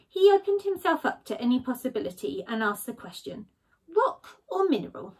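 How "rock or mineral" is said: a lightly voiced Badger, higher and curious; Badger asking. Badger puzzling over his rock, lightly voiced. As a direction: Badger weighing rock against mineral. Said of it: "Badger said".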